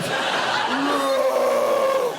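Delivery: deep, loud monster roar; Godzilla roar. Retold or imitated. Sister startled by a grip in the dark, deep Godzilla roar. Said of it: "She sounds like Godzilla".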